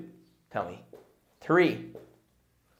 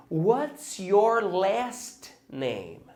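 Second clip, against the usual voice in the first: slow model question; 3 semitones higher, 5 dB quieter, much slower than usual. Shown as a demonstration. Teacher models the question for students, very slow. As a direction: stretch every word very slowly. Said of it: "So if I ask you".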